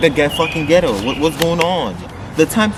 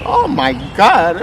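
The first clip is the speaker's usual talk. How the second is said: slow, drawn-out shocked reaction; retold shock; 3 semitones higher, slower than usual. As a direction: stretch out the mock shock.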